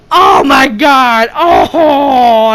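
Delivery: loud, high, drawn-out exclamation; amazed outcry. Retold or imitated. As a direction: re-enact being blown away by the kill. Re-enacting own reaction to the kill.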